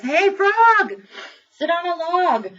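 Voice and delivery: loud, high-pitched storybook cat call; bossy call. Acted. Cat ordering the frog onto a log, bossy call. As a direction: call out loudly and high, ordering someone about.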